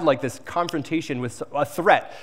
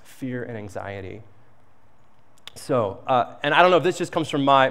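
usual speaking voice

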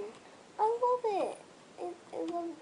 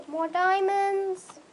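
loud, slow thrilled shout; shouting over found diamonds; excited shout; 9 semitones higher, 11 dB louder, slower than usual. Real, not acted. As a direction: yell it out in delight. Finds more diamonds.

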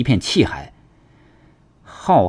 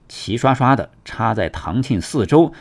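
usual speaking voice

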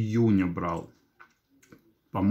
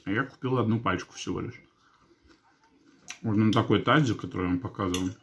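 chewing between words; eating and speaking; mouth full while talking; 3 dB quieter, faster than usual. Real, not acted. Seen 3: Bite taken at -1.0; lips move around food while talking.

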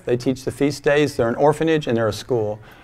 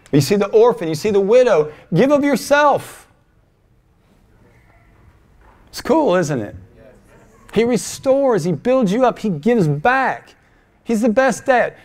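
high-pitched preacher in full swing; impassioned preaching; 7 semitones higher, 3 dB louder than usual. Acted. Preacher urging the congregation to give, impassioned. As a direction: drive each phrase home with fervor.

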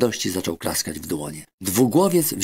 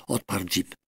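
plain reading voice

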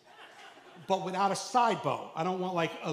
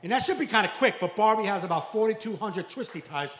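usual speaking voice

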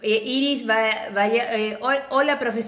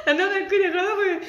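laughing; 9 semitones higher than usual. Real, not acted. Cracking up at friend's joke.

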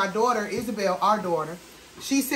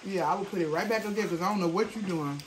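eating between words; talking while chewing; 3 semitones lower, 9 dB quieter than usual. Real, not acted. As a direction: mouth partly full. Seen 0: Side-on man forks pasta toward mouth between lines; chewing partly visible.

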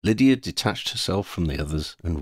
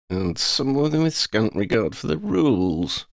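wry; 7 semitones higher than usual. A narrator's reading. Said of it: "Oddie added with a wry smile".

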